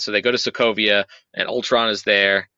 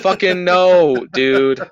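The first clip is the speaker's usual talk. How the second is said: hammed-up big-guy bellow, higher, louder and slow; Hulk; 9 semitones higher, 5 dB louder, much slower than usual. Recapping the film, Hulk turns down Iron Man in a loud slow bellow. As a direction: drag out a mock Hulk roar on no. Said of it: "And Hulk was like".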